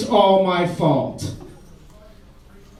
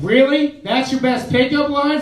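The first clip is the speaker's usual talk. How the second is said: higher, mocking woman's voice; mock scornful woman; 6 semitones higher, slower than usual. Woman mocking his pickup line, higher and scornful. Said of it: "she responded with".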